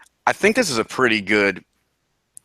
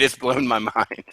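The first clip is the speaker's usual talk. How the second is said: normal talking voice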